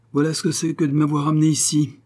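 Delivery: exasperated husband, narrated; angry reproach. A narrator's reading. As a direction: scold with mounting irritation. Husband rebukes his weeping wife.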